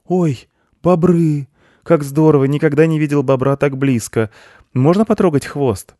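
put-on high, loud, thrilled child's voice; delighted boy. A narrator's reading. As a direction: gush with a child's wonder. Boy delighted to meet beavers. (восторженный громкий детский голос)

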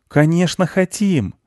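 put-on high child's voice; eager children. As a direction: answer like keen little kids. Children eagerly accept milk.

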